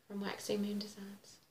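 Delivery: breathy whispered aside. Real, not acted. Showing her new chart to viewers, whispered. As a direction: drop to a secret whisper.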